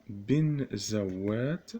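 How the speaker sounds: بنزوات drawn out very slowly; slow dictation. Shown as a demonstration. Teacher dictating to students, بنزوات said slowly. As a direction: spell the name out slowly.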